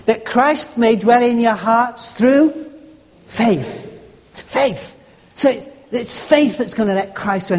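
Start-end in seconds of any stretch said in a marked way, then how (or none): 0.1-3.9 slow build up to "through", a pause, then "faith" alone; stress on faith. Shown as a demonstration. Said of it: "Emphasize the final word". 4.3-4.9 "Faith" said alone, higher, with a falling tune; stress on faith. Shown as a demonstration. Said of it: "Emphasize the final word".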